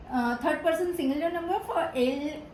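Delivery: ordinary talk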